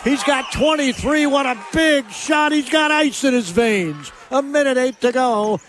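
thrilled sportscaster still riding the moment; excited play-by-play. Real, not acted. Hails the big shot live.

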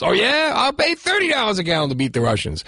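high, fast sarcastic topping; mock one-upping. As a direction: outbid with mock bravado. Mocking escalating bidding war.

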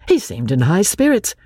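ordinary delivery, nothing marked

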